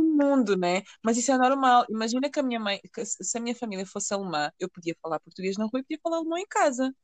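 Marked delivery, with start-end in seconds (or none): none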